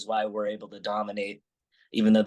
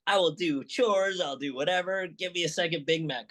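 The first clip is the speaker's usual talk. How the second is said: put-on higher, begging kid voice; pleading child; 7 semitones higher than usual. Kid begging mom for a second Big Mac, pleading. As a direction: bargain like a kid desperate for seconds.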